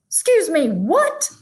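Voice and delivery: usual speaking voice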